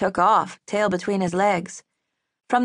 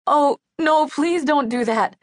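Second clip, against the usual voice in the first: pleading; 7 semitones higher than usual. A narrator's reading. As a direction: beg her not to do it. Girl pleads not to be sent away.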